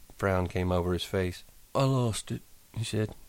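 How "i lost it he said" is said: sad. A narrator's reading. Lenny sad about lost velvet.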